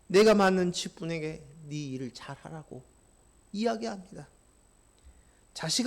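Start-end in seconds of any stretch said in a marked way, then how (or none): none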